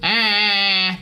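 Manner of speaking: exaggerated pinched cat-like ah; angry cat twang. Shown as a demonstration. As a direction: push the twang until it sounds like an angry cat. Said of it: "pure angry cat".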